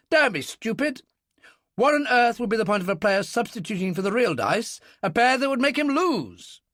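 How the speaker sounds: high and loud, contemptuous; scornful military man. Acted. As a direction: dismiss the fool sharply.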